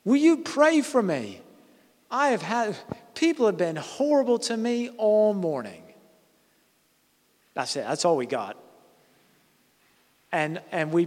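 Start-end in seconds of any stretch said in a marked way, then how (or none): 0.0-5.8 mock yell. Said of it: "yelled out".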